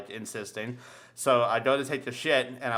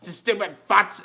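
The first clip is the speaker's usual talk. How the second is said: put-on grumpy old man; 4 semitones higher, 4 dB louder than usual. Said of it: "He's like".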